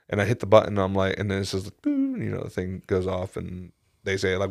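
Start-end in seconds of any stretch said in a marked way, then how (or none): 1.8-2.2 high electronic boop; imitated beep. Customer retelling pressing the order button, high imitated beep. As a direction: mimic an intercom tone going off.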